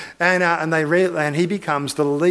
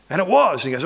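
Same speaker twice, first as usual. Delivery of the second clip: normal talking voice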